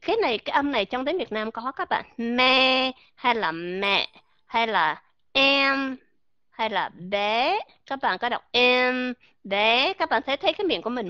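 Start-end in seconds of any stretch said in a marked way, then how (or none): 2.2-7.6 me, mẹ, em, bé drawn out slowly; teacher modelling mouth opening; slow vowel drill. Shown as a demonstration. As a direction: stretch each word. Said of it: "Và các bạn đọc E".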